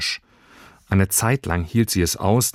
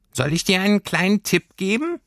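high, tiny creature voice; a small homesickness sprite speaking up; little Heimweh voice; 12 semitones higher than usual. A narrator's reading. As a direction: homesickness creature answering Lilly from above. Heimweh offers advice.

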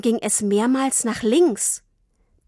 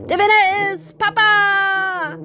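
much higher, slow winner's cry; triumphant; 11 semitones higher, 4 dB louder, slower than usual. A narrator's reading. Father declaring himself race winner, triumphant cry. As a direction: crow the victory.

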